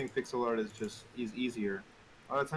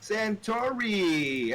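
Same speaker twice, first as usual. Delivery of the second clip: drawn-out greeting; 9 semitones higher, 5 dB louder, much slower than usual. Acted. Greeting the new guy, drawn out.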